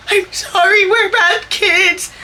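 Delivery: high, drawn-out blubbering apology; mock crying. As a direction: imitate a sobbing, sorry kid. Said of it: "now I'm crying".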